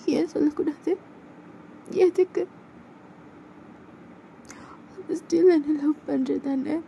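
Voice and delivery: high pitched, slow and searching; asking him if he still loves her; upset girl, slow and high; pleading young woman. Acted.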